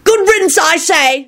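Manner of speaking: very high, screeching witch; defiant screeching witch. A narrator's reading. Hostile witch cheers the minister leaving, screeching high. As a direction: screech it out in triumph. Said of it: "screeched one of the anti-werewolf witches defiantly".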